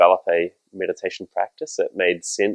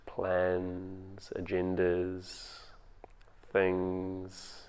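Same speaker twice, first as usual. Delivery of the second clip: calm, unhurried meditation voice with long pauses; guided meditation; 10 dB quieter than usual. Acted. Teacher guiding home listeners through their breathing, in a calm meditation voice. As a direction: ease them into the breath, gently.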